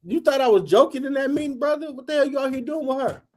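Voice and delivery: imitating himself yelling at a young line brother; retold scolding. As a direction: chew the kid out in the hallway. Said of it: "All I heard was".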